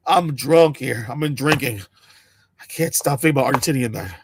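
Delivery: put-on low, slurred drunk mumble; lightly voiced drunk guy; mock drunk voice. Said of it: "this guy is drunk".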